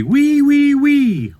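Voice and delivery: very high, loud "wee" squeals; piggy squeal. Acted. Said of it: "The wee little pig say".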